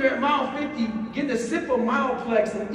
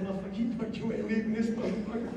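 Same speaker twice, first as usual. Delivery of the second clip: inner voice; 7 dB quieter, faster than usual. Retold or imitated. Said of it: "they said".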